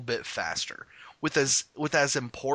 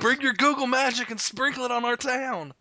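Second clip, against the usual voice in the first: mock plea; 11 semitones higher, 4 dB louder than usual.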